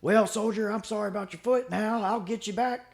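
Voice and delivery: high-pitched impression of an eccentric old vet; imitated old veteran. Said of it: "one of those older guys".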